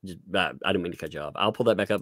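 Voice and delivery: ordinary talk, nothing marked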